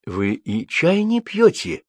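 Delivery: slow question climbing high; incredulous, high-lifted query; surprised. A narrator's reading. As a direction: ask in drawn-out amazement. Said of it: "удивился Реджинальд".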